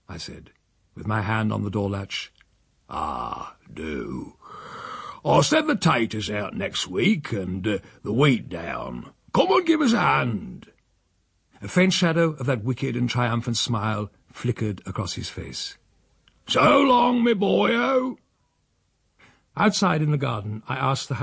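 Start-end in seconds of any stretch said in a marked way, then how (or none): 2.9-10.7 put-on old uncle. Old uncle saying goodbye. 16.5-18.2 hearty farewell call. Acted. Uncle's triumphant farewell.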